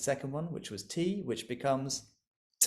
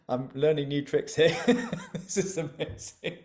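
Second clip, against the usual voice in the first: laughing; 4 semitones higher, 4 dB louder than usual. Real, not acted. Laughing at Zoom emojis.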